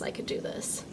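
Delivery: breathy whispered aside. Real, not acted. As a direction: whisper it to yourself. Whispered to herself.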